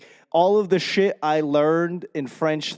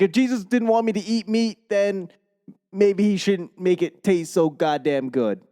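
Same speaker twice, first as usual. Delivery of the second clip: higher-pitched mock protest; mock indignant retort; 6 semitones higher than usual. Husband protesting Lent to wife, mock indignation. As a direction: argue it like an aggrieved meat lover. Retorting about giving up meat.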